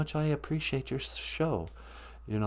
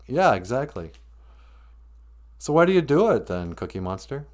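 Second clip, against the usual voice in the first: ordinary delivery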